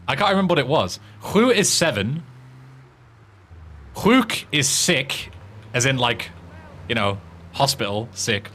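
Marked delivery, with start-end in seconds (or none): 4.0-5.3 "Sjuk" drawn out very slowly and raised, with an "h" sound at the start; careful Swedish word. Shown as a demonstration. Gamer teaching a Swedish word to his friends, said very slowly. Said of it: "What, for the Swedish one?".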